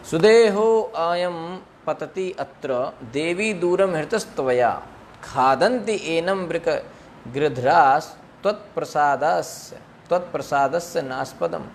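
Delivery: scripture recitation; Sanskrit verse recitation. Acted.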